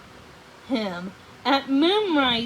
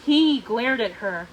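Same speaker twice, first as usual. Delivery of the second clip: usual speaking voice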